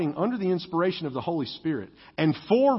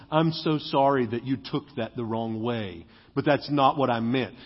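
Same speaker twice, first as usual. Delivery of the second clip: mock fake apology; 7 semitones lower, 3 dB quieter than usual.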